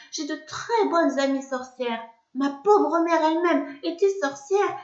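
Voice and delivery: high, begging plea; pleading. Acted. Narrator begs the witch for mercy, high and pleading. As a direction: beg on your knees for mercy. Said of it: "je me mets à supplier".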